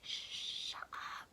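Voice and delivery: hissed whisper telling him to hush; urgent whisper. Retold or imitated. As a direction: whisper so mom doesn't hear. Hiding the spill from mom.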